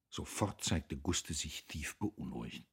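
ordinary delivery, nothing marked

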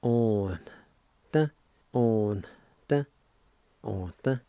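On, the, slow and separate; syllables On the. Shown as a demonstration. Teacher sounding out On, the, for the class. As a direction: sound out On the twice over. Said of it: "It's pronounced like this".

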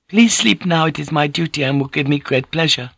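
put-on high, gentle, accented woman's voice; humbly grateful, pitched well up; deferential Japanese consort. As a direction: speak as a devoted, courteous consort. Voices Fujiko in the dialogue.

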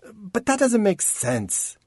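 complain, half whining; whiny. Acted. Rain complains to Chance with a whine. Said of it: "She could hear the whine in her voice".

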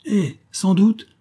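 high, loud, drawn-out exclamation; exclaiming. A narrator's reading. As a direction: cry it out slowly and emphatically. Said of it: "s'écria Raoul".

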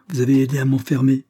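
a woman crying out in anger; angry outcry. A narrator's reading. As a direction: hurl the accusation at him. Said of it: "s'écria-t-elle".

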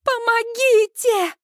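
high, stretched cry for help; panicked high-pitched plea; frantic scream. A narrator's reading. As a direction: wail desperately for rescue. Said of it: "истошно ору я".

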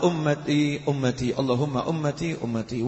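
low, subdued retold plea; tearful plea. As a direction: softly imitate a weeping prayer. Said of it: "Wa baka, dan menangis".

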